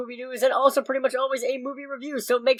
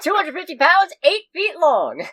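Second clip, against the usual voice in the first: pitchman voice; 6 semitones higher than usual. Acted.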